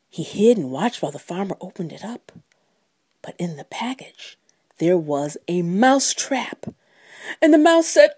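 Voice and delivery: ordinary delivery, nothing marked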